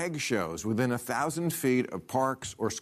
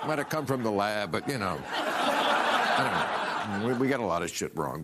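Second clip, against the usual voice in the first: put-on valley girl voice. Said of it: "They're talking like a valley girl?".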